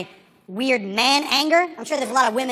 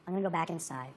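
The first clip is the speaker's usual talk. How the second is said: much softer, lower hushed aside; hushed, confiding a heads-up; soft whisper-like; 11 semitones lower, 15 dB quieter than usual. Retold or imitated. Friend softly leaving him.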